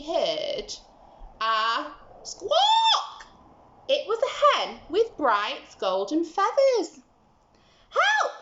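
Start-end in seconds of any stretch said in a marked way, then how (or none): 1.4-3.3 squawk. Acted. Hen squawks. 7.9-8.3 very high, clucking hen cry. Acted. The golden hen calls to Jack, clucking high. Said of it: "she clucked".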